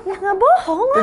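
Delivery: high, slow, laughing it off; laughing denial. Real, not acted. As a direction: protest through a laugh. Denies it while laughing.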